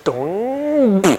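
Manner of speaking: long, loud drawn-out "dong"; comic boing noise. Retold or imitated. Preacher imitating spit blown back in the wind, drawn-out comic boing. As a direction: stretch out a cartoonish rebound sound.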